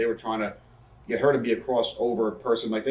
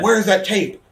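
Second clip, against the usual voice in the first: high, loud imitation of an irate boss; Vince yelling; 10 semitones higher, 8 dB louder than usual. Boss demanding a missing tape, yelling. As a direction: demand it at a yell. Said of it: "Vince starts yelling".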